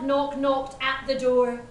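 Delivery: ordinary delivery, nothing marked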